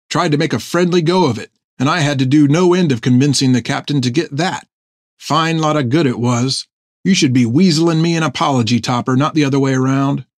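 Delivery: lilting, grumbling seaman's voice; aggrieved sailor. Acted. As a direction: complain to a shipmate like the wronged party. Narrator voices Fred grumbling.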